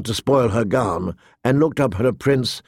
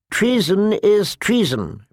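higher, slow pronouncement; stern old counsellor; 8 semitones higher than usual. A narrator's reading. Pycelle answers the girl at once, slow firm verdict. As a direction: pronounce it slowly and firmly. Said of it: "Pycelle replied at once".

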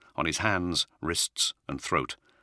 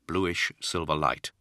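usual speaking voice

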